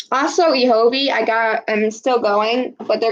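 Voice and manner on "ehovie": ordinary talk, nothing marked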